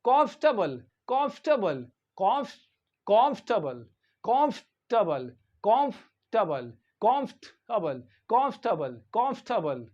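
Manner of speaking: कंफर्टेबल repeated as "KOMF-tuh-bul", falling each time; कंफर्टेबल with stressed first syllable and no R; pronunciation drill. Shown as a demonstration. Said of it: "इस तरह से पढ़ते हैं".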